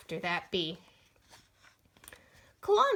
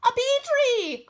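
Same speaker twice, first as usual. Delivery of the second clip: much higher, loud call; calling back; 12 semitones higher, 7 dB louder than usual. Acted. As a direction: call back over your shoulder. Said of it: "a voice called back".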